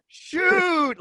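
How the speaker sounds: high mock yell of Shoot; urging the player, high and loud; retold yell. Arena announcer urging a teammate to shoot, high yell. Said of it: "and I'm going".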